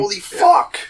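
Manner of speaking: squeaky high-pitched disbelief; the roll is absurd, cry out in shock; amazed exclamation. Real, not acted. Reacting to a roll of thirty-one.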